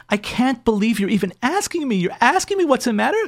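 high-pitched, indignant retold wife; imitated wounded outrage, pitch climbing; resentful. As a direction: act out a wife accusing her husband in disbelief. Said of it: "she's, like, resentful".

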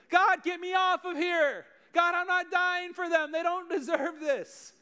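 a comic tantrum yelled up to heaven from the cross; preacher mock-screaming at God to kill them; mock outraged shout. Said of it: "I'd be like".

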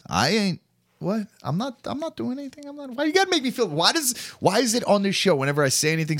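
whiny protest. Real, not acted. Host protesting his co-host, whiny. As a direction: complain like the victim.

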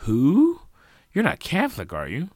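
loud, high disbelief; startled question. A narrator's reading. Sally questions Jacob about the reverend, startled. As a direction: blurt it out in surprise.

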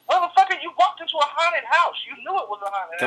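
high, exasperated rebuke; exasperated scolding. Pretend. Comic scolding a haunted-house complainer, high and exasperated. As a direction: talk down to a fool who walked into a haunted house.

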